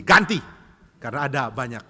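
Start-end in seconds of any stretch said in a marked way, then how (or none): 0.0-0.4 loud one-word order; acted strict shout, raised pitch; fierce. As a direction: order the replacement sharply. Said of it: "jadi galak ya".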